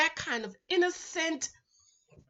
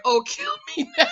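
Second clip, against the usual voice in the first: mock despair; 5 dB louder than usual. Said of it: "I was like".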